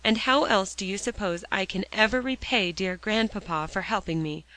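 plain reading voice

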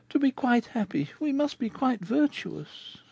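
sighing. Acted. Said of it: "with a deep sigh".

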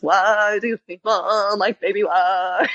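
slow, drawn-out alien impression. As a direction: stretch it like a garbled call. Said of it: "You sound like this".

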